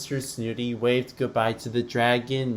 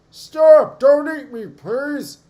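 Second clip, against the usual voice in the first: very high, loud pleading scream; terrified shout; 13 dB louder, slower than usual. Acted. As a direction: shriek at the dragon to stop. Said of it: "he shouted".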